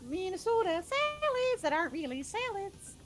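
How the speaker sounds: high, slow, exaggerated Minnesota accent; mock Minnesota accent. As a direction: stretch it out in broad Minnesotan. Mocking Minnesota accent on salads.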